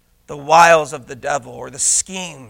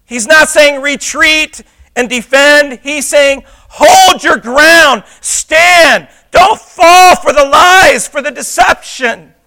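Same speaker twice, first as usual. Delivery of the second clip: loud, high, drawn-out shouted exhortation; full-force preaching; 10 semitones higher, 9 dB louder, slower than usual. Real, not acted. Preacher urging believers to hold their ground, full-force preaching. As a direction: shout it out like a battle charge.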